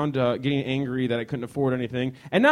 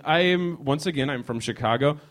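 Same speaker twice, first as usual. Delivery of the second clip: usual speaking voice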